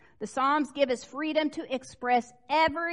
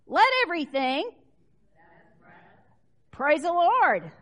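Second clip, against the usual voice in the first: praise call; 5 semitones higher, 8 dB louder than usual. Real, not acted. Repeated with force.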